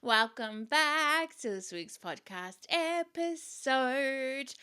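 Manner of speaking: high, slow, stretched-out welcome; sing-song host intro. Real, not acted. Host welcoming listeners back to the podcast, sing-song. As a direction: draw out the words like a show opener.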